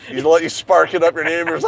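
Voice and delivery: ordinary delivery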